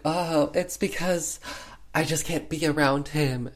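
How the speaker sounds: high-pitched mock anguish; melodramatic, play-acted reluctance; hamming it up. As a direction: confess like a bad soap actress. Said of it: "definitely hamming it up".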